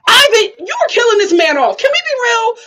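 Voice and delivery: high, loud playful scolding; exasperated call-out. Real, not acted. Scolding Ivan over his dad's description, playful exasperation. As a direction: call him out loudly, pitched right up.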